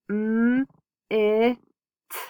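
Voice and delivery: "mm, eh, t" each sound said slowly and apart. Shown as a demonstration. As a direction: sound out "mm, eh, t" one phoneme at a time. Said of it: "So that says".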